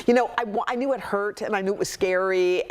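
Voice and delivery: ordinary delivery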